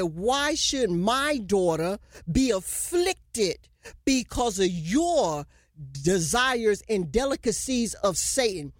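slow, heavy questioning as the Lord; slow grieved question. Acted. The Lord asking the unfaithful husbands, slow and grieved.

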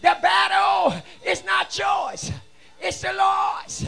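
loud, high declarations to the congregation; fervent preacher shouting praise; full-force preaching. Real, not acted. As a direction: preach it hard, stretching out the key words.

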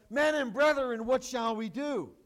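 loud pleading cry of a stricken crowd. Retold or imitated. Crowd cut to the heart begs the apostles, crying out loud. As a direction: call out to the apostles desperately, loudly. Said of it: "cried out and said".

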